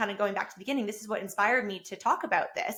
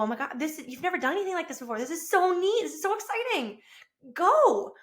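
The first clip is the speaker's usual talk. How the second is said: rising higher, fast thrilled gushing; excited gushing; 7 semitones higher than usual. Retold or imitated. Wife gushing over husband's trip, rising excitement. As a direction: gush with delight.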